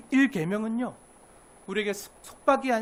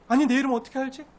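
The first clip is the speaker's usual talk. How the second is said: surprised; 5 semitones higher, 7 dB louder than usual. Acted. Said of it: "놀란 거예요".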